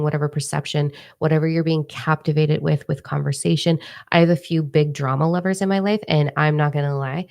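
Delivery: ordinary talk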